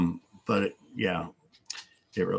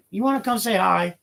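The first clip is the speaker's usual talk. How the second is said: much higher coaxing dog voice; pet talk to dog; 12 semitones higher, 3 dB louder than usual. Real, not acted. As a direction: coax the dog over in a high sing-song.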